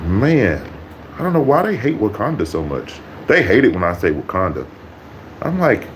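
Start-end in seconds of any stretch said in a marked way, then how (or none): none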